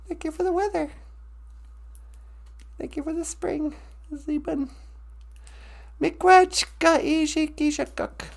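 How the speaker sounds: hushed grateful prayer. Acted. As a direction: pray quietly before speaking. Opening prayer.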